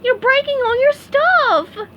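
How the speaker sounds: very high, loud, slow alarmed cry; alarmed plea. Acted. SpongeBob warns Patrick wrecking his room, high alarmed cry. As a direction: warn a friend in panic. SpongeBob pleading over the chaos.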